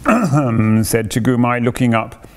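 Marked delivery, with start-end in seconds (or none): 0.1-0.8 acted little throat-clear; throat-clearing "ahem". Tagumai looking up before naming a new noise, clearing his throat.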